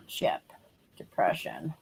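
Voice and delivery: whispered notes to self; whispering. Real, not acted. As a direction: whisper as you jot it down. Writing notes to herself.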